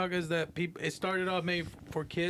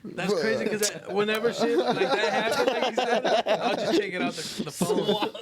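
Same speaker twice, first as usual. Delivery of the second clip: higher and louder, laughing through it; laughing confession; 5 semitones higher, 5 dB louder than usual. Real, not acted. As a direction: giggle while admitting it.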